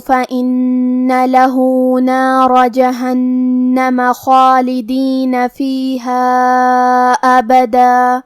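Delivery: slow, chanted tajweed recitation; Quran recitation. Shown as a demonstration. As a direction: draw the verses out in melodic recitation. (تجوید کے ساتھ تلاوت)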